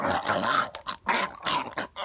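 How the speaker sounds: voiceless, rasping mouth noise; imitated squelching. Tentacled bullets hitting you, imitated squelching. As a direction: make a creepy burrowing sound effect.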